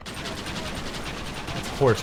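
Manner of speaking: slow, hushed whisper. Pretend. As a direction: breathe it out in shock. Mourning the shot horse.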